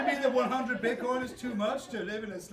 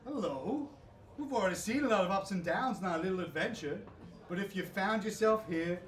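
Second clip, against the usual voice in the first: theatrical host narration; game master narration; 3 semitones lower, 3 dB quieter than usual. A narrator's reading. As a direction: set the scene like a show host.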